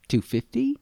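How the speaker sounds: a high, hesitant questioning offer; tentative, uncertain counteroffer; unsure. A narrator's reading. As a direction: ask it like you doubt your own number. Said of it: "He sounds unsure of himself".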